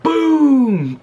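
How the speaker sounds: high loud boom; loud crash boom. Retold or imitated. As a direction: shout the impact. Retelling the crash.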